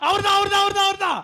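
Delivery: high, loud excited cry; pointing and yelling with glee; excited recognition. Acted. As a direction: blurt it out, thrilled you found him. Excited spotting the man.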